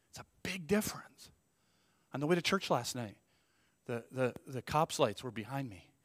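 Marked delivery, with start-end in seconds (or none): 0.1-1.3 whispered aside. Real, not acted. An aside to the congregation.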